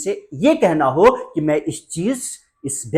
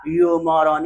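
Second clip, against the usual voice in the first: stylish insult; much slower than usual. Shown as a demonstration. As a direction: demonstrate "you moron" slowly and with style. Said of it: "थोड़ा style में कमी मत करिएगा".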